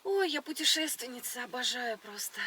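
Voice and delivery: ordinary talk, nothing marked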